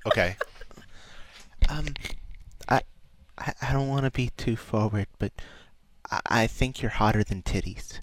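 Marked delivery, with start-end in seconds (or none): 1.7-8.0 shy, much softer pony voice; timid whispery-soft Fluttershy flirt. Pretend. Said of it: "as Flutters".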